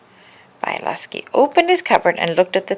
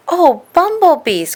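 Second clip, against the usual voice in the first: high, slow cry of dismay; a bear's wail at an empty pot; oh no, high and drawn out; 11 semitones higher, 7 dB louder than usual. A narrator's reading. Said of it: "cried Bialowski".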